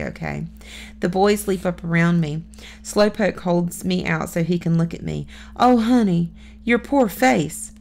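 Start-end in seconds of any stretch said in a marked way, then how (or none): 5.6-7.7 tender concern. Acted. Rescuer comforts hurt girl.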